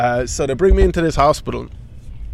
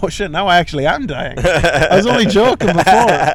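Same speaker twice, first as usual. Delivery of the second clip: mild mock panic, building louder; hammed-up realisation of dying, getting louder; 4 semitones higher, 5 dB louder than usual. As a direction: play-act alarm with laughter creeping in. Acted panic at spurting blood.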